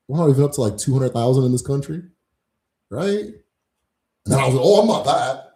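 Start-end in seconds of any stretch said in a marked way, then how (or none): 4.6-5.4 higher, louder mock protest; raised-voice imitation of an indignant denial. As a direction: act out someone loudly disowning it. Acting out someone disowning the group.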